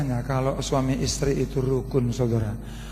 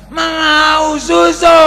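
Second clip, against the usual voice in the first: put-on high, slow, shouted child's whine; crying child; 13 semitones higher, 11 dB louder, much slower than usual. Preacher mimics his daughter calling for milk, high drawn-out shout. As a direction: drag it out like a child demanding milk.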